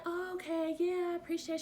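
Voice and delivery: high, bright mock of a friend saying thanks; lightly mocking friend on the phone; friend's voice.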